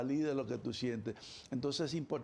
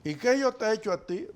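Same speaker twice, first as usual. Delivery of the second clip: defensive partner; 7 semitones higher, 8 dB louder than usual. Retold or imitated. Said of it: "creemos que es por culpa de nosotros".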